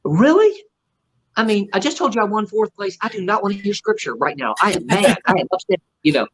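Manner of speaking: mock indignant. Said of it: "I thought".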